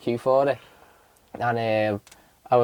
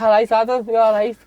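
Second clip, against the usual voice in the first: much higher, louder chirpy hello; overfamiliar mate greeting; 10 semitones higher, 3 dB louder than usual. Retold or imitated. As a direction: hail them like old pals, pitched up and bright. Greeting fighters as best mates.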